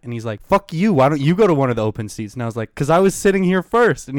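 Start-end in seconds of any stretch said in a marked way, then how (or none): 0.4-2.3 imitated angry retort. Stranger swearing back at passenger over seats, mock angry outburst. 2.8-4.1 high, loud protest; retold indignant comeback. Passenger defending his seat to stranger, re-enacted indignant comeback.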